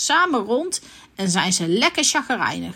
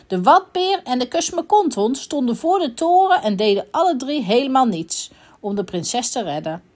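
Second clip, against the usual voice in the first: usual speaking voice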